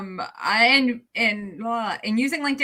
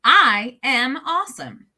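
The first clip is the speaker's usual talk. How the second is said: slow, a bit louder, echoing back; slow echoed affirmation; 3 semitones higher, 6 dB louder, slower than usual. Shown as a demonstration. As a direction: repeat it slowly and firmly. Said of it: "you're gonna say it back to me".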